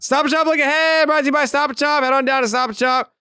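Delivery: high, loud sing-out sponsor read; hammed-up, high-pitched promo shout; mock sponsor announcement. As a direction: belt it out high like an over-the-top ad announcer.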